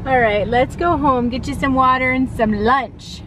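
ordinary talk, nothing marked

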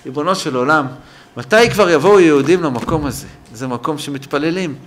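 retold child's plea to God, loud and rising; pleading prayer. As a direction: cry out the questions as an urgent prayer. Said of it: "אני מתפלל, אני אומר לו". (תפילת ילד מתחננת, בקול רם)

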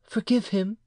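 high woman's voice; pleading mother. A narrator's reading. Mother excuses her son to the uncle, pleading. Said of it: "answered the mother".

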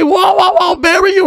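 high, startled whoa at the proposal; shocked whoa. Real, not acted. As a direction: yelp it out in disbelief. Sudden marriage proposal in book.

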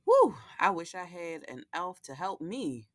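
lively picture-book read-aloud with character voices; reading to children. A narrator's reading. Host reading Lola the Elf to young viewers, storytelling voice. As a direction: read it like a bedtime story for little ones.

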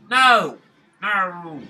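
sharp high yell of dismay; real frustrated no; frustrated shout. Real, not acted. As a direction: yell no at the screen. Game frustration outburst.

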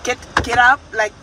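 loud urgent call to wake up; waking the family in a panic, loud urgent call. Retold or imitated. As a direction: rouse the house, loud and urgent.

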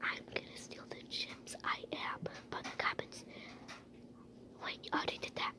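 fully whispered scheming; sneaky whisper. Pretend. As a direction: keep the plan secret, barely breathed.

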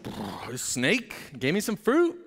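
lightly voiced meek excuse, slow; a hint of a guilty wife; sheepish Eve. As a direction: Eve blaming the snake. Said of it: "And Eve's like".

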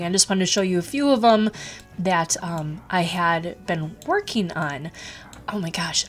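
ordinary delivery

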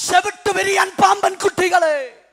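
loud, high, thundering denunciation; the Lord's rebuke. Acted. Preacher quoting the Lord addressing the deaf, shouted rebuke. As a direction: hurl it like a divine reproach. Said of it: "ஆண்டவர் அவனை பார்த்து சொல்றார்".

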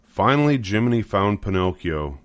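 ordinary delivery, nothing marked

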